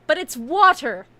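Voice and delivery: venting about the dumped water; exasperated. Real, not acted. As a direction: hit it like the last straw. Ranting about water confiscated.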